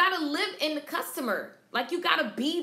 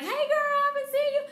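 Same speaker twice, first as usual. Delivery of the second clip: excited greeting; 12 semitones higher, 4 dB louder, much faster than usual. Retold or imitated. Customers greeting each other excitedly.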